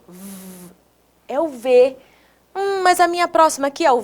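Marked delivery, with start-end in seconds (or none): 0.1-0.7 "V" as a long buzzing consonant, no vowel; vibrating V sound. Shown as a demonstration. Teacher shows pupils the letter's sound, vibrating V. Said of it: "Ó, vibrou".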